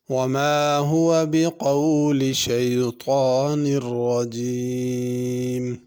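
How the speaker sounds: slow, drawn-out chanted recitation; measured melodic tilawat; Quran recitation. Shown as a demonstration. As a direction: recite it like the teacher modelling tajweed. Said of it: "teacher recites verse to model tajweed".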